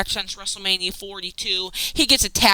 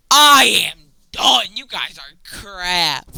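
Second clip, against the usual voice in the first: fed up; 4 dB louder, slower than usual. Real, not acted. Giving up on Padres.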